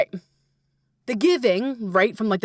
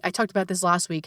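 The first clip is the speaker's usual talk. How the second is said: ordinary talk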